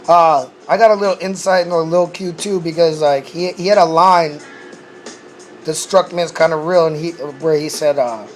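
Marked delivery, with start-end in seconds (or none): none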